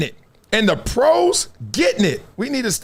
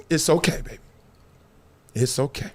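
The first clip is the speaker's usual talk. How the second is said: soothing; 4 semitones lower, 5 dB quieter than usual. Retold or imitated. Comforting the mock mourner.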